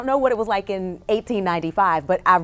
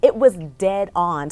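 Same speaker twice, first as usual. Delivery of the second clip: ordinary talk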